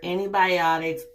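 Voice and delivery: ordinary talk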